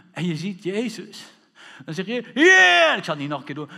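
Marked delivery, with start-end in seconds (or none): 2.3-3.0 high, loud shout for rescue; all-out desperate cry. Acted. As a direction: cry out with everything in you. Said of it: "Met alles wat in je". (uit alle macht roepen)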